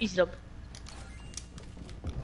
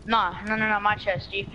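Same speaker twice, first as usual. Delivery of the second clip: ordinary talk, nothing marked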